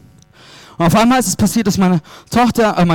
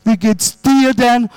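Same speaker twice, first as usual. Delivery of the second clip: higher, slow and drawn out, a doting father's voice; fond dad; 6 semitones higher, slower than usual. Retold or imitated. Father calling his grown son just to hear him, slow and doting. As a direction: play the dad who just wants to chat.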